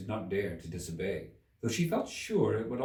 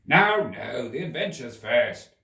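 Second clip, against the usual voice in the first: louder, hurried Gryphon; impatient, a bit louder; 2 semitones higher, 7 dB louder than usual. Acted. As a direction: brush them aside, impatient. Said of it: "in an impatient tone".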